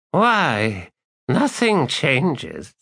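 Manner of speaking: high, slow, tired rasp; weary ancient Jagut. Acted. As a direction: drag the words out, bored. The Jagut answers from within.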